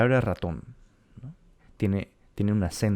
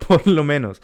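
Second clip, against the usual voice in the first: laughing loud, higher-pitched echo; 7 semitones higher, 10 dB louder than usual. Real, not acted. Laughing back at the other's shaky spelling, laughing. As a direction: repeat it through a burst of laughter. Both laugh at his admission.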